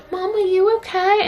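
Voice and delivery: put-on high childish worried voice; imitating the son. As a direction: ask as a scared little boy, high. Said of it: "the son's just like".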